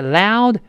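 "loud" with a full ow and no burst on the d; unreleased d. Shown as a demonstration. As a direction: hold back the final d of "loud". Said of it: "最后那个的失去爆破". (“loud”双元音饱满，d失去爆破)

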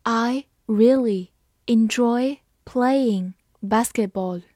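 slow, drawn out; slow reading. Shown as a demonstration. Teacher reading slowly for students to copy. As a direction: read it slowly and clearly. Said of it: "好，慢慢来读。".

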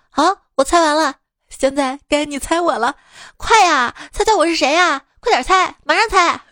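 pushy urging. Retold or imitated. Comic turning the guessing game on a phone scammer, pushy urging.

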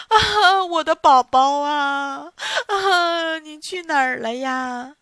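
slow, drawn-out wailing sob; mother weeping over the empty bed; sobbing. Acted. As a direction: cry out in despair for the baby. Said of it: "呜呜的哭了起来".

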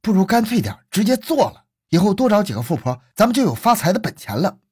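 high, intense villain's scheming; vicious urging. A narrator's reading. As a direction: urge the crime on viciously. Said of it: "恶狠狠地说".

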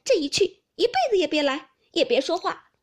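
high, loud parting shout; angry. A narrator's reading. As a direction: shout after him in a fit of temper. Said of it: "不禁自己越发添了气". (赌气地高声怒喊)

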